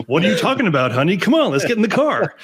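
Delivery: playful put-on broadcast voice; show host voice. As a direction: answer your wife in a mock radio-host voice. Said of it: "you're doing the Hugh Brownback voice".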